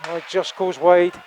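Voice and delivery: excited build-up. Acted. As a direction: build toward the goal.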